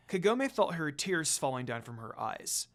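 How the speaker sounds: usual speaking voice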